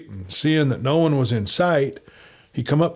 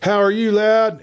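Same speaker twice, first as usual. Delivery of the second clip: high, loud, put-on hearty hail; calling out loud; 11 semitones higher, 5 dB louder than usual. A narrator's reading. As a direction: sing out so the next room hears. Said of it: "sung out so his clerk in the outside room could hear".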